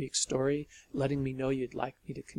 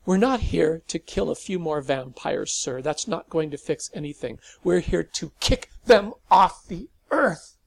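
louder, higher fervent declaration building to a shout; passionate outburst; 4 semitones higher, 10 dB louder than usual. Acted. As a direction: proclaim the mission with conviction. Teen bursts in passionately.

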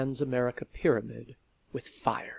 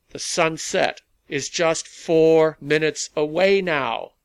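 loud announcement to a rally; addressing a crowd; 3 semitones higher, 8 dB louder than usual. A narrator's reading. Rally leader counting down to sunset for the crowd, projecting loudly. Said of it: "Malcolm said into his headset".

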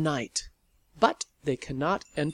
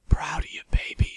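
unvoiced whisper close to the ear; 3 dB louder, faster than usual. A narrator's reading. Woman behind her partner, proud of her, whispering in her ear. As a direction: whisper it privately to someone beside you. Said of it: "she whispered in Sally's ear".

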